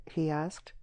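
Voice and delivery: plain reading voice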